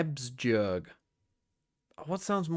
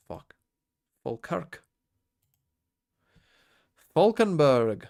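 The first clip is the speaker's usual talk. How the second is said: ordinary talk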